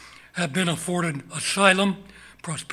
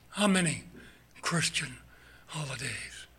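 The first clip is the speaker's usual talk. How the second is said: hushed whisper, trailing off; 6 dB quieter than usual. Acted. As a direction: repeat it softly, almost to yourself. Same question repeated in whisper.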